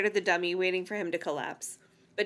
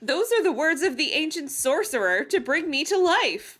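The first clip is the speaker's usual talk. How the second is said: proclaiming; 6 semitones higher, 3 dB louder than usual. A narrator's reading. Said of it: "he proclaimed".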